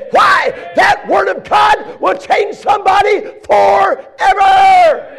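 high, shouted revival preaching building in force; full-force preaching. Real, not acted. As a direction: build to a loud pulpit climax.

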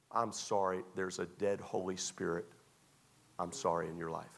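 ordinary talk, nothing marked

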